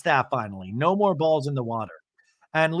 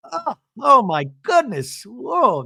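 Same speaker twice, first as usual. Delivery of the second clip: high, slow mock wonder; Bronwyn, awed; 9 semitones higher, slower than usual. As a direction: gasp out the awe in a high imitation. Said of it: "Bronwyn goes".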